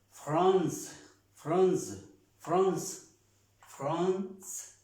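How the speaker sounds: "France" in French, ending on a hissed S sound, no E; "France" as a teacher's model, final E silent. Shown as a demonstration. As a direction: say "France" loud and high, stopping on the C as S. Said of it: "no estamos pronunciando la E".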